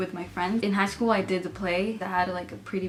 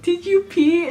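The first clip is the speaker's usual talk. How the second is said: high, loud giggling question; laughing question; 10 semitones higher, 8 dB louder, slower than usual. Retold or imitated. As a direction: ask it while laughing. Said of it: "she's like".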